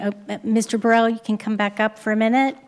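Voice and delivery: ordinary talk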